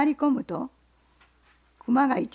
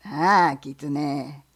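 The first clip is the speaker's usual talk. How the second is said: bear; 4 semitones lower, 4 dB louder than usual. Acted. Bear asks the fox what he sells, low and loud. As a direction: growl the question like a bear.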